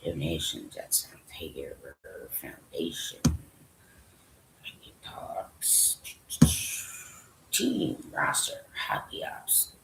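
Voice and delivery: whispered, barely audible read-through; reading under breath. Real, not acted. Speaker skimming a team staff list to himself, whispering. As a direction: whisper it to yourself while scanning.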